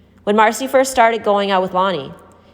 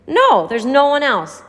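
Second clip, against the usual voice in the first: gasped; 9 semitones higher, 4 dB louder, slower than usual. A narrator's reading. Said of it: "Marcy had gasped".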